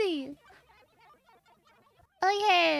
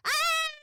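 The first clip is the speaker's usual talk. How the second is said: scream; 14 semitones higher, 3 dB louder than usual. Acted. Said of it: "I'll scream".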